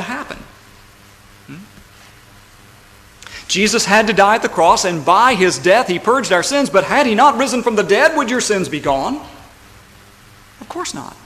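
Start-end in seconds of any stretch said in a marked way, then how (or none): 3.5-9.2 loud, insistent pulpit argument; forceful preaching. Real, not acted. Preacher arguing the cross needed the resurrection, forceful preaching. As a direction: drive the argument home, rising in pitch.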